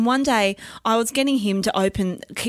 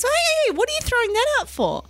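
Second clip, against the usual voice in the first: alarmed protest. Retold or imitated. Son stops Parmesan being binned.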